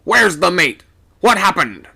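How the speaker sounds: captain demanding what happened, loud; urgent demand. A narrator's reading. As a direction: demand an answer.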